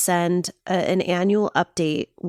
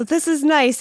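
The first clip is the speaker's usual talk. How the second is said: mock delight; 9 semitones higher, slower than usual. Viewer swooning over movie actor.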